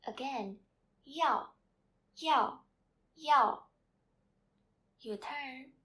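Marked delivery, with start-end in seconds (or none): 1.1-3.6 "Yao" very loud, high, falling steeply; fourth tone. Shown as a demonstration. Teacher drilling students to repeat, fourth tone on "Yao". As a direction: punch each "Yao" separately, pitch dropping fast. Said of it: "word with the fourth sound is yao".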